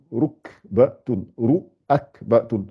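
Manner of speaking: "Rukbatun, ruakbatun" slowly broken into syllables. Shown as a demonstration. Teacher separating letters for learners, "Rukbatun, ruakbatun" syllable by syllable. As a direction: say "Rukbatun, ruakbatun" piece by piece, pausing between syllables. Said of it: "séparer les lettres et de les assembler".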